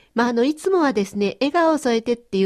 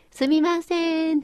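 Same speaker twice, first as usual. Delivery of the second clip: higher, slow call to get a clerk's attention; 8 semitones higher, slower than usual. Shown as a demonstration. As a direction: call 「すみません」 across the shop, drawn out. Calling a shop clerk.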